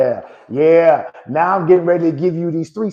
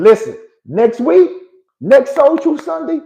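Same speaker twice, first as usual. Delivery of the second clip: excited promo; 11 semitones higher, 7 dB louder than usual. Real, not acted. Hyping next week's episode.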